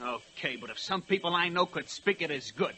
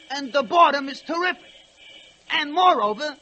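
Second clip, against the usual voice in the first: higher, mocking old-lady whine; mimicking old ladies; 7 semitones higher, 5 dB louder than usual. To the fellas, mocking the bored old ladies. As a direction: lay it on in a mock dame voice. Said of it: "old dames was complaining".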